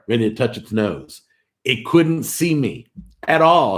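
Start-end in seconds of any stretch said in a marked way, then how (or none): 1.6-3.8 higher and louder, rising to at all; gushing with thrill; excited. Real, not acted. Streamer tells viewers about the hunting tent, excited. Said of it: "Sorry, I'm getting a little excited".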